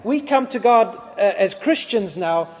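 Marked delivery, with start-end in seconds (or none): none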